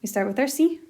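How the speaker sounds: ordinary talk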